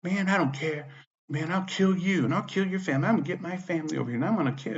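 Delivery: high, mocking voice of a ranting woman; imitated threatening woman. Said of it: "And she says".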